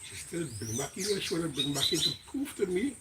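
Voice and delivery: ordinary delivery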